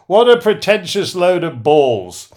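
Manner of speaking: a loud, scoffing Yorkshire put-down; Parky's Yorkshire accent. Retold or imitated. Talk show host mocks a newspaper review, loud Yorkshire scoff. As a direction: sneer it out in Yorkshire. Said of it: "in his Yorkshire accent".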